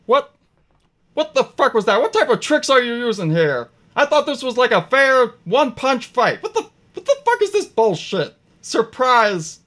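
high, loud gnome rant; indignant gnome. Acted. Gnome accusing Thaddeus and Mickey of tricks, high indignant rant. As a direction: protest it all in a high gnome voice. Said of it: "looks at Mickey and goes".

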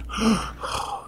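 a sharp high gasp of delight; mock gasp of surprise. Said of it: "he was like".

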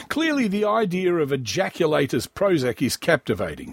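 normal talking voice

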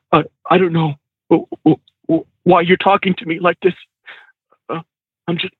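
halting mock-tearful stammer; imitated tearful student. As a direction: stumble over the words, lightly imitating someone near tears. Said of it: "he starts crying".

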